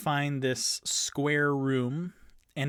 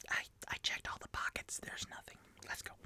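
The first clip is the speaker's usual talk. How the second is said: hushed, whispered report from a rogue; sneaky whisper; 13 dB quieter, faster than usual. Acted. Tipsy tells the party the pockets are empty, whispering.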